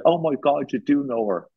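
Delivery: mock amazed exclamation; imitated surprise. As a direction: play her amazed recognition lightly. Imitating the woman's delighted surprise.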